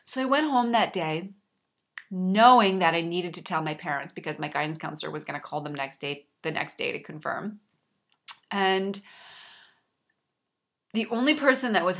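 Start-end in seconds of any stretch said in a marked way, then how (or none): none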